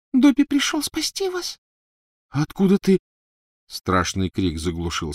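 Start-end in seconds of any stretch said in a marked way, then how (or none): 0.1-1.6 very high squeaky house-elf. A narrator's reading. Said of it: "Пропищал он тоненьким голоском". 2.3-3.0 startled. A narrator's reading. Harry startled question.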